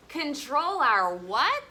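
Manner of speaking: drawn out, rising on what; prompting question. Shown as a demonstration. Teacher prompting the listening kids to fill in the word. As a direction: leave the what hanging for the kids. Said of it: "prompting the class to fill in".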